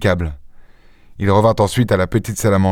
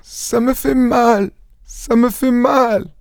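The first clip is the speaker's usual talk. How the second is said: very high, drawn-out moan of pain; pained stammer; 14 semitones higher, much slower than usual. A narrator's reading. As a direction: wail it slowly, far up in pitch. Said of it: "bégaya-t-elle". (plainte de douleur, aiguë et traînante)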